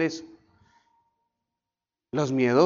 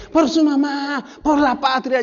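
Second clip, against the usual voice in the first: much higher, louder rallying yell; sergeant's shout; 12 semitones higher, 7 dB louder than usual. Retold or imitated. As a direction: fire up the recruit at full volume. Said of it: "el sargento decía".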